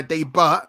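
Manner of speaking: "debut" said as "day-butt", T sounded; joke mispronunciation. Pretend. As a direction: say "debut" with a hard final T. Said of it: "'cause there is a T at the end".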